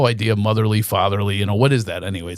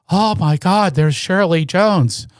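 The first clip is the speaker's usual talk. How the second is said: higher, starstruck mom voice; excited mother; 6 semitones higher than usual. Retold or imitated. Son imitating mother spotting Shirley Jones. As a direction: gush then console yourself. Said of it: "And she goes".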